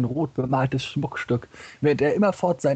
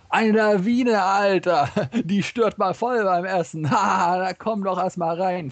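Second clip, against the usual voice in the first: gleeful punchline; 6 semitones higher, slower than usual. Acted. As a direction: blurt it out, cracking yourself up. Marx yells out his joke's punchline.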